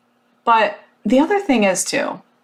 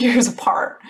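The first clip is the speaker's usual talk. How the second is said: ordinary delivery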